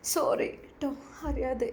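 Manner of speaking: soft, breathless sorry; a hushed, grieving apology; sad whispered apology. Acted. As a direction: whisper it, ashamed and hurt. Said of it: "വല്ലാതെ സങ്കടപ്പെട്ടു".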